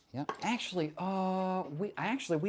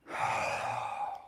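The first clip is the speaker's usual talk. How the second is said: long exhaled sigh; 5 dB quieter than usual. Real, not acted. Exhaling during stretch.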